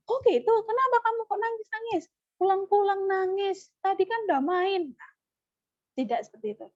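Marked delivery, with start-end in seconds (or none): 0.1-4.9 much higher, sharp scolding parent; angry scolding. Shown as a demonstration. Parent scolding a child who came home crying, angry.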